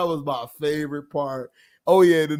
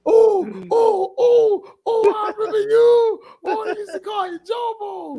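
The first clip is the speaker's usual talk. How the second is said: higher, shouted through laughter; mock shout of recognition. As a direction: yell it like you just spotted them.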